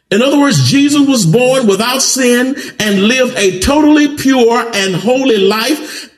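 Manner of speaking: lifted preaching. Acted. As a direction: keep the sermon lifted. Preaching, raised.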